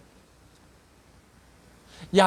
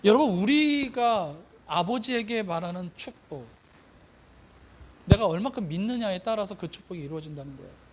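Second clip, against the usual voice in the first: ordinary delivery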